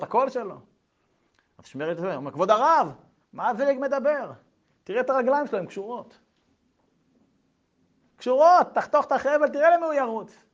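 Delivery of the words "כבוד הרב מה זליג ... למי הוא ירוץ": high, loud pleading to the rabbi; urgent plea. Acted. Shmerl urging the rabbi to cut the rope, high loud plea.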